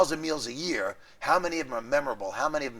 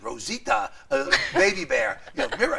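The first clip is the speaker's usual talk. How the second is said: lisping bear; 6 semitones higher than usual. Retold or imitated. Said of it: "a bear on Sesame Street who has a lisp".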